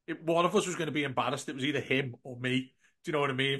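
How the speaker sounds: normal talking voice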